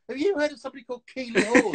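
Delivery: high, loud, quick, laughing as she asks; can't keep a straight face asking about a celebrity; laughing disbelief. Retold or imitated. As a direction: giggle through the question in disbelief. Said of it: "on the phone to Tara going".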